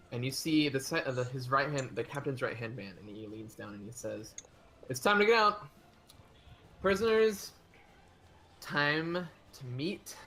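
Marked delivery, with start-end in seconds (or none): 4.9-10.1 gruff guard order. Acted. Captain's man orders prisoners out.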